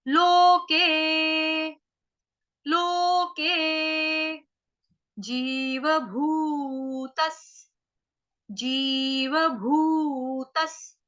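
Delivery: लोके and जीवभूतस् drawn out very slowly; very slow syllable demo. Shown as a demonstration. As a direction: spell out जीवभूतस् with a final स. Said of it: "ज के साथ आपकी कौन सी मात्रा". (लोके, जीवभूतस् बहुत धीरे)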